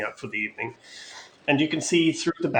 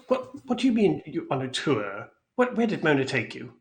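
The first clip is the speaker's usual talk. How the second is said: higher, suspicious, pressing questions; alarmed Mister Light; 6 semitones higher than usual. Acted. Worried Mister Light questioning the guest about Mona. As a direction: interrogate the guest anxiously.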